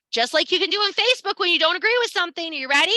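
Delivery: pumped-up hype. Real, not acted. Teacher hyping her students, fast and loud. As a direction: fire the class up like a hype host.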